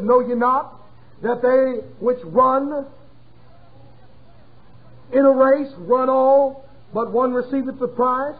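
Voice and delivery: slow, solemn King James reading with drawn-out words; scripture reading. Acted. Preacher reading Corinthians to his congregation, slow and solemn. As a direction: read the verse like holy writ.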